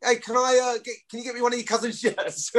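high, shy, sheepish ask; squeaky nervous request of a young fan; timid kid asking. Pretend. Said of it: "didn't have the balls to go up to him and go".

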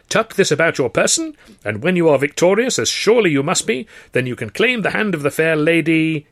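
put-on high, loud and hammy old trouper; hearty theatrical gent. A narrator's reading. George gushing to Arthur about the contest, hearty theatrical. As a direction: play the grand showman gushing.

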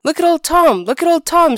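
high, loud alarmed cry; screaming. A narrator's reading. Wife screams at her husband about the cat, high and loud. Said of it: "screamed his wife".

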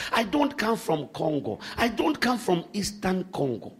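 usual speaking voice

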